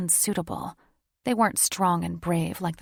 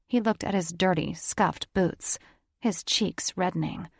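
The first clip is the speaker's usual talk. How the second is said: usual speaking voice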